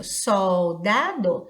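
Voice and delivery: SOL-DA-DO slowly stretched into three beats; syllable by syllable. Shown as a demonstration. Teacher showing three mouth openings, Soldado slowly syllabified. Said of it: "você abriu a boca três vezes".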